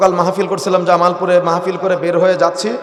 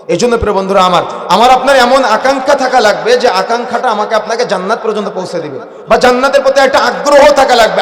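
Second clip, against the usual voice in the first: raised, louder sermon voice; forceful preaching; 5 semitones higher, 6 dB louder than usual. Real, not acted.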